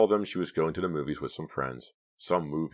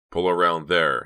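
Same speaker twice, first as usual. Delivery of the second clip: louder, firm command; instructing; 2 semitones higher, 8 dB louder than usual. A narrator's reading. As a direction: give the driver a firm order. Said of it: "he instructed".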